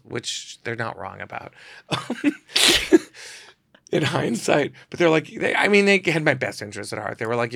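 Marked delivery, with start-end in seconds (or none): 1.9-3.4 breathy burst of laughter; laughing. Real, not acted. Laughing at his parents' verdict. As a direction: laugh at the joke.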